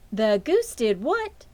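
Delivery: slow, loud, startled question; a touch of shocked mother; surprised mother. A narrator's reading. Mother asking Fern in surprise, slowly.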